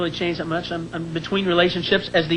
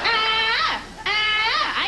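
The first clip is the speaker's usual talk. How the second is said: mock wail. Wife voice turning to wail.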